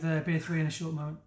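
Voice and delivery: ordinary talk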